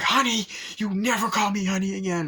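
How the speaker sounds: high, hammed-up scolding; imitated angry wife. Captured wife to her husband, mock fury. Retold wife's angry outburst.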